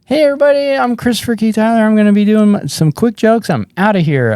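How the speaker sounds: high, chirpy comic's intro; stage greeting. Retold or imitated. Comic greets the crowd before quick jokes, high stage voice. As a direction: open your set in a high, hurried voice.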